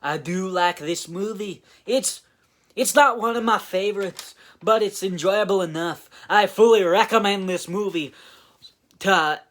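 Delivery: loud, high-pitched mock Scottish accent. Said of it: "I gotta do a Scottish accent now".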